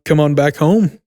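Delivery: ordinary talk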